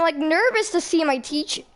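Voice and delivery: ordinary talk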